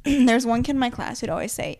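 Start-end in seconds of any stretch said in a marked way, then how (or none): none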